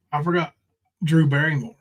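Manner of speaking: normal talking voice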